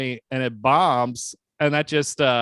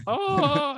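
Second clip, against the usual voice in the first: very high "Oh" yelp; high exclamation; 13 semitones higher than usual. Shown as a demonstration. Confirming his reaction when things drop, a high yelp. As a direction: squeal it high. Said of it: "I go".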